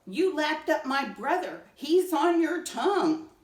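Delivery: high, loud panicked cry; frantic tiny ant begging; panicked plea. Acted. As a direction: beg him not to swallow, in alarm. Frightened ant pleading to Stinky.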